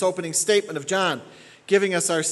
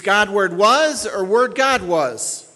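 "God Word was" and "Word God was" spaced out slowly and loudly, pitched higher; 4 semitones higher, 6 dB louder, slower than usual. Shown as a demonstration. Preacher reads both word orders to the congregation, slow and weighty. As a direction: lay out each reordered word slowly and firmly. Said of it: "So this reads".

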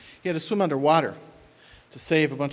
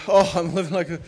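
mock counselee; 6 semitones higher, 6 dB louder than usual. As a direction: parody someone bragging they're saved. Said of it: "when they say".

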